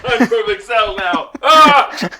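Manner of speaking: villain boast. Pretend.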